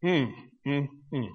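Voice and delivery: low, soft "hmm" repeated with pauses; quiet, spaced-out hums of misgiving; pondering hums. Acted. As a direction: hum doubtfully, mulling it over. Pondering the lie just read.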